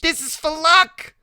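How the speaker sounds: very high, loud, slow announcement; Loretta, teasing. Acted. Loretta knuckles the boy's head.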